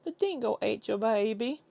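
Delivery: put-on Aussie accent; mock Australian accent. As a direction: quote it in an exaggerated Australian accent. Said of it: "Americans reacting to Australian things".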